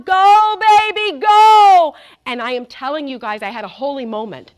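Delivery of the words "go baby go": high, very loud holler; cheering on. Retold or imitated. Father cheering his kid on stage, loud holler. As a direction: whoop it out to urge your kid on. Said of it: "cheering his kid on".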